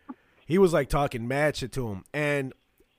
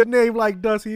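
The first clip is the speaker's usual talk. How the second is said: laughing mock; 7 semitones higher, 8 dB louder than usual. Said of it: "He goes".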